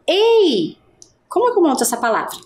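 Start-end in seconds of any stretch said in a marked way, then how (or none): 0.1-0.8 teacher demoing how to call out "ei". Shown as a demonstration. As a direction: call "ei" higher, like getting a stranger's attention. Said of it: "eu digo".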